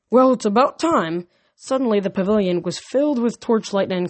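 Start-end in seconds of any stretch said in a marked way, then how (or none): 0.1-1.3 growled. A narrator's reading. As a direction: growl it out loud. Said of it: "a voice behind us growled".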